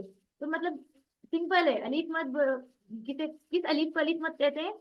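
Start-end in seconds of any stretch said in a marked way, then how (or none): none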